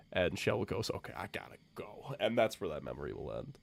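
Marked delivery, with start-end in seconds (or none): 0.9-2.1 whispered and fast; awkward whispered exit; quick whisper. Acted. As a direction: whisper it and hurry off. Shell leaves awkwardly.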